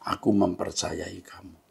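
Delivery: soft, hushed words from within; inner voice. Retold or imitated. God answers the weeping pastor, softly and hushed. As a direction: say it gently as a voice in the heart. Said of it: "saya mendengar suara di hati saya".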